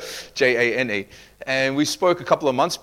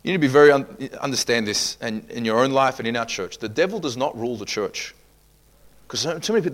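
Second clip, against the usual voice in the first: ordinary talk, nothing marked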